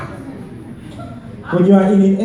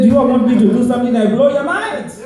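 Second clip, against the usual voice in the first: high, fast, hyped-up shout to the crowd; hype shout; 7 semitones higher, faster than usual. Acted. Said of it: "I said".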